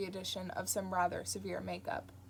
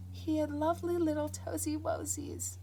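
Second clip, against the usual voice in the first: put-on mild baby talk; 8 semitones higher than usual. Entranced nun recalls baby.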